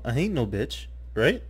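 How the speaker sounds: normal talking voice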